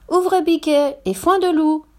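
high, singsong password call; mother goat calling her kids; mother goat's call. A narrator's reading.

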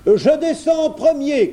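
high, loud bear yell; shouting. A narrator's reading. Bear climbs on the well's rim, shouting. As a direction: claim first turn at the top of the bear's voice. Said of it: "cria-t-il".

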